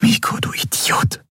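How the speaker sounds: voiceless, hushed reproach; whisper. A narrator's reading. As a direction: whisper it at the unconscious boy's side. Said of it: "flüsterte sie". (geflüstert, fast tonlos)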